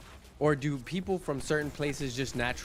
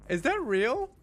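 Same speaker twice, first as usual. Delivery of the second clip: surprised; 13 semitones higher, 7 dB louder than usual. Real, not acted. Reacting to chat claim.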